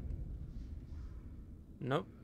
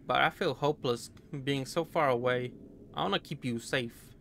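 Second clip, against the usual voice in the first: put-on American accent. Player reading a soldier's letter to his girl, light American accent. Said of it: "stereotypical American accent".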